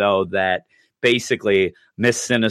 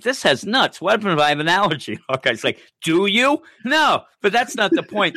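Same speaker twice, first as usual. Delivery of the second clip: lightly voiced fussy kid; 9 semitones higher than usual. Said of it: "and he's like".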